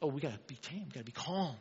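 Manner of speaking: mock-meek murmur, lightly voiced; mock timid churchgoer. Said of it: "we do the same thing at the church".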